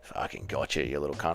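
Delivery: low gloating whisper of a villain; a hoarse sneering hiss over the trapped mouse; creepy villain gloats at his catch; evil Dougie. Pretend. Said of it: "impression of evil Dougie".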